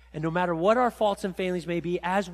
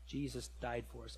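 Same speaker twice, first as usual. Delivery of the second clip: ordinary talk, nothing marked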